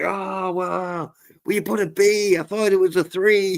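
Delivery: put-on high, fumbling old man voice; confused old man. Scambaiter playing an old man to the scammer, high doddery voice. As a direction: play a doddery old man who misread the code. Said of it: "pretending to be like an old man".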